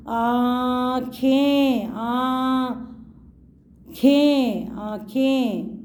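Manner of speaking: आँखें drawn out very slowly, nasal vowel stretched; teacher stretching आँखें for children; slow syllable demo. Shown as a demonstration. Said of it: "अब आँखों में हम क्या लगाएंगे?".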